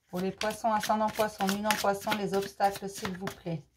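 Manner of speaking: quiet card invocation; murmured invocation. Real, not acted. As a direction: murmur the question to the deck like a ritual. Murmured request to the cards.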